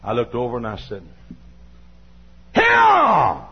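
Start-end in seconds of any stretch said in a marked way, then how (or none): 2.5-3.5 high, loud sharp bark; sharp shout. Retold or imitated. Preacher shouting at an unruly toddler, sharp bark. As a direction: snap the word out to freeze the child. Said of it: "That's the magic word".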